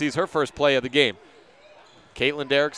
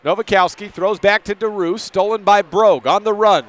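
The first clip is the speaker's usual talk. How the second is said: higher, louder steal call; excited call; 5 semitones higher, 6 dB louder than usual. Real, not acted. Announcer calling a steal on the run, excited. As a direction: jump on the steal with urgency.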